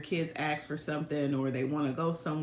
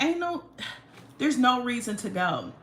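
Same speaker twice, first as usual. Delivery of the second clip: put-on whiny teen; 7 semitones higher, 3 dB louder than usual. Teen self giving up.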